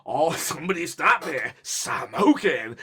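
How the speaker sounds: half-hearted Mask impression with a laugh; tossed-off imitation of a manic cartoon; mock Mask quote. Vlogger mocking his friend's Mask quote, half-heartedly. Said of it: "He just watched The Mask".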